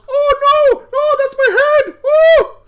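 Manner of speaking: hammed-up high, loud panicked shriek; panicked puppet. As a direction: squeal as your head is yanked off. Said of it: "and it goes".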